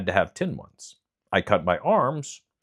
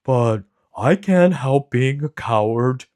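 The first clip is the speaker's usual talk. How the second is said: slow, loud, sorrowful lion voice; a big cat owning his shame; sad lion; 5 semitones higher, 7 dB louder, slower than usual. A narrator's reading. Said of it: "he said sadly".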